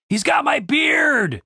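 high, slow panicked shout; panicked cry. A narrator's reading. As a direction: yell for help in alarm. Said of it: "cried Dave".

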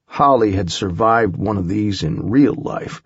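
plain reading voice

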